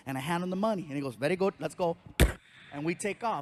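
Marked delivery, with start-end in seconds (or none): 1.1-2.0 put-on Indian accent. Cab driver voiced.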